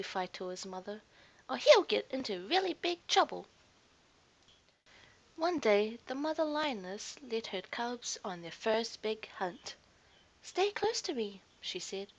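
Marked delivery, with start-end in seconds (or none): 1.5-3.5 louder, higher scolding warning; warning lionesses. A narrator's reading. Lionesses scolding the cub's mother, stern warning. 10.4-11.4 higher, urgent warning to the cubs; protective mother. A narrator's reading. Mother lioness to her cubs before the hunt, urgent.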